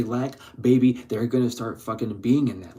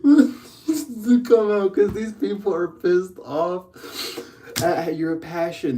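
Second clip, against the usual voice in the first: high, slow, louder laughing talk; words squeezed out through laughter; 7 semitones higher, 3 dB louder, slower than usual. Real, not acted. Telling viewers the reading while cracking up.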